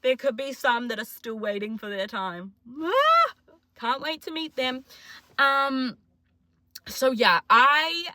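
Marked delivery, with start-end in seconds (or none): 2.8-4.8 mock squeal. Mock delight at future diagnoses.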